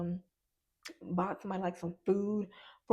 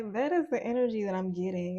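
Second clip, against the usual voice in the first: ordinary talk, nothing marked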